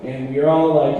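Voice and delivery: ordinary talk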